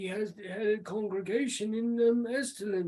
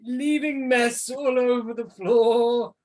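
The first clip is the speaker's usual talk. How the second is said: sneering at the peasants; disdainful noble; 3 semitones higher, 3 dB louder than usual. Acted. GM voicing a disdainful NPC.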